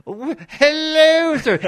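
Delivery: very high, slow, loud startled squeak. Retold or imitated. Drawlight caught off guard by Norrell, high startled squeak. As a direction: jump out of your skin mid-greeting.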